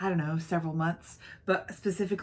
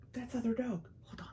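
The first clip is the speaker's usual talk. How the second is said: surprised whisper; 4 semitones higher, 10 dB quieter than usual. Real, not acted. Dog walks in mid-video.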